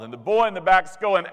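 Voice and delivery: ordinary delivery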